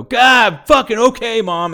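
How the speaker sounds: loud, high, exasperated imitation of a son; man yelling at mom. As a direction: shout back, fed up with your mother. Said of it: "I hear a man's voice go".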